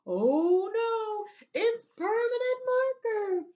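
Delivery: high, slow, wailing dismay; loud anguished teacher voice. Acted. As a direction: cry it out like a horrified teacher. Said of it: "And then the teacher said".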